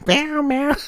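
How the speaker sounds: high mewing cat imitation, far above own voice; cat meowing. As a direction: mew like an insistent cat wanting attention. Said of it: "He's like".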